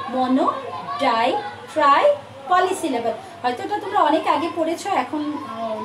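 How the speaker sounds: ordinary talk, nothing marked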